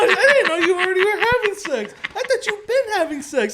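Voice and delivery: mock-surprised mom. Son imitating his mom's reaction to his confession, mock surprise.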